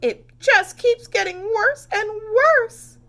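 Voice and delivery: high, loud, slow sobbing words; crying. A narrator's reading. Missus Cream cries that Camilla keeps getting worse. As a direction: wail it through tears. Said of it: "cried Missus Cream".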